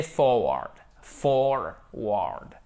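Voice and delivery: "forward" said low and slowly for learners; English pronunciation demo. Shown as a demonstration. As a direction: model "forward" carefully, FOR then WARD. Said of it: "faites bien attention, c'est vraiment une forme très particulière". (prononciation anglaise appliquée de « forward »)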